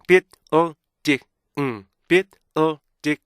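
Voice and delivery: BUILDING chopped into bi-l-di-ng, each beat separate; "building" in four detached beats, ending on a hummed ng; four micro-syllables. Shown as a demonstration. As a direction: punch out "building" piece by piece with stops between. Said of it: "이렇게 총 네 개 미세 음절을".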